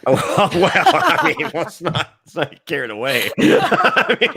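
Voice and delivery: talking through real laughter; high, breaking up with laughter; laughing. Real, not acted. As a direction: laugh helplessly while getting the words out. Cracking up at co-host's joke.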